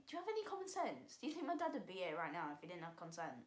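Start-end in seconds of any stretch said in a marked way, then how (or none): 0.1-2.2 exasperated. Retold or imitated. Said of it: "I was just thinking".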